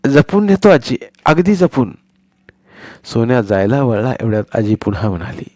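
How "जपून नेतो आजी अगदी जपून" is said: put-on a higher boy's voice, sweetly; butter her up; promising boy. Boy Sonya promising grandmother to be careful, sweet.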